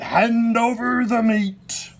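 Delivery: high, loud, slow pirate voice; pirate demand. A narrator's reading. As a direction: demand it with a full mouth. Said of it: "Braidbeard gulped his food and said".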